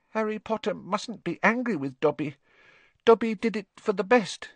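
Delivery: high, pleading elf voice; squeaky house-elf. A narrator's reading. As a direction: beg like a timid little elf.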